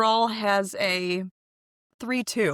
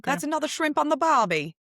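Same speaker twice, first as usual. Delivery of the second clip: high, hammed-up Australian accent; mock Australian accent; 7 semitones higher than usual. Joking back at her cohost in a mock Australian accent. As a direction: toss off an Aussie cliché in a play accent. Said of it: "you're really doing Australian".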